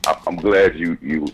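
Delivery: ordinary talk, nothing marked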